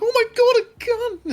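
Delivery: high, loud mock panic; hammed-up scared civilian crying out at a gun; scared guard. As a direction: shriek it like a terrified guard. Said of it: "He'd be like".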